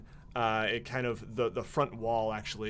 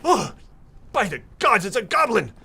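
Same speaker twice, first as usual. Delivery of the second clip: much higher, shouted mock alarm; startled outcry; 11 semitones higher, 12 dB louder than usual. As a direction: cry out loudly on spotting a goblin. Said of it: "one of them turns to you and goes".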